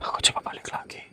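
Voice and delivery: hushed unvoiced whisper while moving closer. Real, not acted. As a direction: whisper the plan so nothing hears. Going back toward the spirit.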